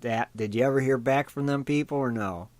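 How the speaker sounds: ordinary talk, nothing marked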